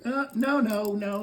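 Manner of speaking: high mock refusal; squeaky nervous no's; Adam turning down the apple, high voice; Adam refusing. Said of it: "He says".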